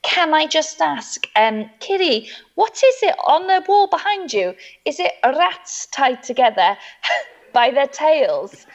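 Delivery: imitating a high, hesitant questioner; concerned course leader. Fostering trainer querying the wall decoration, delicately concerned. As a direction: ask delicately, stretching the words. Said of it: "the woman who's running the course said".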